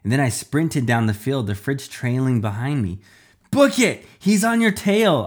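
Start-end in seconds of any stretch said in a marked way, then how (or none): 3.5-5.2 loud, high-pitched urgent yell; yelled warning. A narrator's reading. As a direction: shout it out to someone being chased. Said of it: "Iris yelled".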